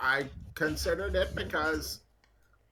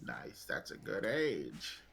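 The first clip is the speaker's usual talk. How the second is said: low, soft, drawn-out murmur; creepy approval; 5 semitones lower, 6 dB quieter, slower than usual. Acted. Old family friend approving a youngster's age, creepily slow. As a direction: savor it slowly, low and quiet.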